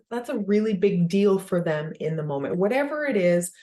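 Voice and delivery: normal talking voice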